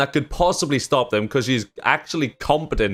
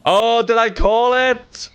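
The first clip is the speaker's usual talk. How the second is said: much higher, drawn-out triumphant cry; real excited high-pitched gloating; voice shooting up; 10 semitones higher, 4 dB louder, slower than usual. Real, not acted. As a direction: crow it slowly in a voice pitched way up. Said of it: "you made my voice go up, like, seven octaves right there".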